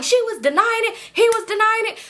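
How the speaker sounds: high, insistent mock yell; retold screaming. Defending herself to cousin on the phone, high mock scream. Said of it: "So I'm screaming in the phone".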